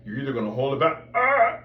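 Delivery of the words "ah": high, loud pinched ah; much higher, louder strained ah; tight voice. Shown as a demonstration. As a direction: hold the breath back and squeeze it. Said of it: "become a tight person's".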